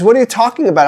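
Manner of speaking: sharp, high and loud disbelief; as if the claim is absurd; incredulous outburst. Retold or imitated. Wife objecting in disbelief.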